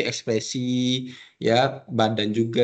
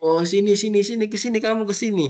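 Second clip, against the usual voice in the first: higher, louder call across the room; wrong way, shouting for a deaf friend; 9 semitones higher, 3 dB louder, faster than usual. Shown as a demonstration. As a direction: call them over from afar.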